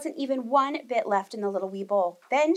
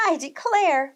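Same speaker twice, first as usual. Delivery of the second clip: higher, louder exclamation; exclaiming; 6 semitones higher, 5 dB louder, slower than usual. Acted. Mama Bear reacts to the escape, higher loud exclamation. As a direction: exclaim it, scandalized. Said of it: "Mama Bear exclaimed".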